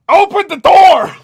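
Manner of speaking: loud, high and slow yell; stretched loud yell. Retold or imitated. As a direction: bellow it at the door. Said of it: "Sybil, you yell out".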